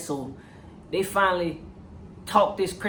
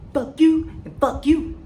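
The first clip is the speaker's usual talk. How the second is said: higher and louder, slow and deliberate; defiant telling-off; 7 semitones higher, 4 dB louder, slower than usual. Retold or imitated. Ward dismissing the internal affairs cops, slow loud defiance. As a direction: spit each fuck you out.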